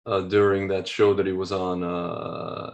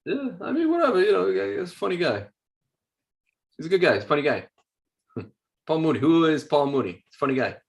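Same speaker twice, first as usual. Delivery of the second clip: high-pitched impression, easing down by the end; comic impression; 6 semitones higher than usual. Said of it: "that was my best impression of".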